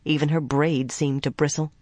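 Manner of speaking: plain reading voice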